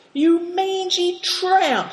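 high, drawn-out angry snarl; high-pitched, stretched-out abuse; snarled insult. A narrator's reading. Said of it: "snarled Greencoat".

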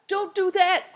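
high, loud, alarmed protest; flabbergasted cry. A narrator's reading. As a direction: cry out to stop him, aghast. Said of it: "cried Affliction, flabbergasted".

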